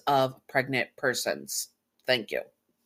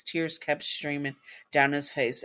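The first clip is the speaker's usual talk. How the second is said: ordinary delivery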